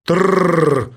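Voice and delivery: rattling drill sound made by mouth; imitated drilling noise. A narrator's reading. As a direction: roll the R like a drill boring.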